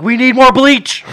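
high, drawn-out frantic yell; loud high-pitched holler; urgent yelling. Acted. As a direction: yell it in a panic at dad. Acted panic to dad walking in.

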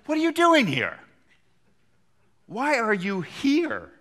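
high and loud, slowing on the second question; God's challenge. Acted. God confronts Elijah in cave.

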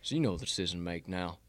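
low, quiet coach impression; folksy recruiting pitch, soft and deep; Saban. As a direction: talk a recruit round in a low, understated voice. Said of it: "He's spot on".

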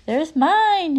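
put-on high, loud kid shout; excited shout. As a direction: yell it out like a thrilled child. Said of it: "he shouted".

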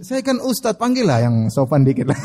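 normal talking voice

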